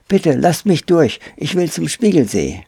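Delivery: put-on high, pleading boy's voice; Pole Pole, pleading. A narrator's reading. Boy begs the surrounding apes to let him pass, pleading. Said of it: "Er war sich nicht mehr sicher".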